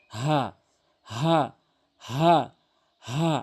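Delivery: "হা" breathed from the start of the throat; demonstrated ha. Shown as a demonstration. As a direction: say "হা" as separate, clear syllables. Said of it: "এভাবে উচ্চারণ করতে হবে".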